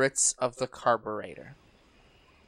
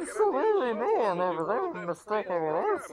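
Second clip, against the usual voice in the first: muffled Bane voice; 8 semitones higher, 3 dB quieter than usual. Acted. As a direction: talk through a hand over the mouth. Bane voice continues.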